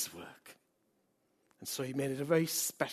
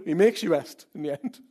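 normal talking voice

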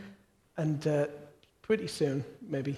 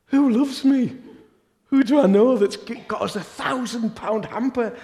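excited inner voice; 6 semitones higher, 8 dB louder than usual. Retold or imitated. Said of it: "I was thinking".

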